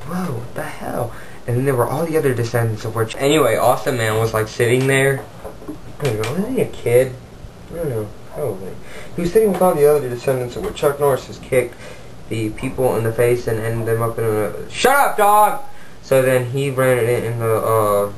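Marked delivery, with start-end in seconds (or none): 0.0-1.1 slow, whispered mock astonishment; hushed amazement. Fighter finds himself in another universe, hushed amazement. 14.7-15.6 sudden high yell at a dog; real annoyed shout. Real, not acted. Storyteller interrupted by the dog, annoyed shout.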